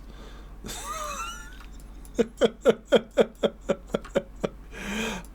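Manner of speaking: long high burst of laughter. Real, not acted. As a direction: crack up helplessly. Laughs after near miss.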